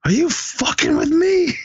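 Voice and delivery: very high exasperated mock outburst. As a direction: lose it with the kid, exasperated. Willie losing it at the kid.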